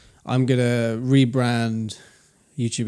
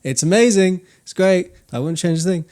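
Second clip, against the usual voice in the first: higher, louder gushing praise; mock enthusiasm; 6 semitones higher, 5 dB louder than usual. Man joking about life with his partner, mock enthusiasm. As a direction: oversell it as if covering yourself.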